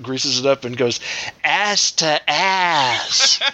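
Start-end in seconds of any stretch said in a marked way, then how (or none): 1.4-3.4 very slow, higher sleazy drawl; skeezy old guy. Acted. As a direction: stretch it out like a creep. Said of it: "a skeezy old guy who pops out".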